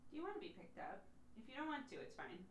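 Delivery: soft, high coaxing; gentle pet voice. Real, not acted. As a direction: coax the cat softly. Asking the cat gently.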